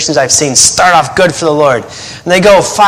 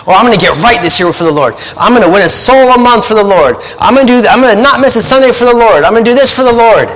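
mock eager vows; 6 semitones higher than usual. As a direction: sound like a fired-up new believer listing resolutions. Mock eager New Year vows.

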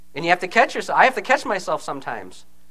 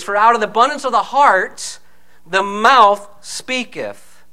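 loud, slowing to a drawn-out close; preacher reading the King James verse; preaching scripture; 5 dB louder than usual. Acted. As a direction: proclaim the verse, stretching the last words. Quoting scripture in sermon.

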